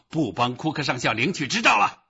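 loud, high angry declaration; furious shouting. A narrator's reading. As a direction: shout the final decision in a temper. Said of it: "他大喊道".

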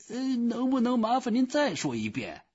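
very high, hesitant clerk; high-voiced, baffled official; puzzled phone clerk. A narrator's reading. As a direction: ask politely in a high put-on voice. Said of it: "电话那头说道".

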